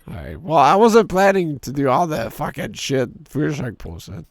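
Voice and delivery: normal talking voice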